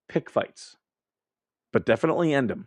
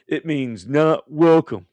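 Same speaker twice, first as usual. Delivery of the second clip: slow, drawn-out, louder and higher movie-line delivery; movie line quote; 4 semitones higher, 5 dB louder, slower than usual. Retold or imitated. Quoting a film line to his viewers, slow and loud. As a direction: spell out each word like the film. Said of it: "If you guys know the movie".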